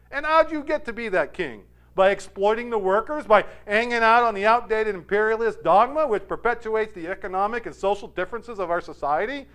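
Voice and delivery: ranting on and on. Retold or imitated. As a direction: imitate a peasant lecturing the king. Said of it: "touting on and on".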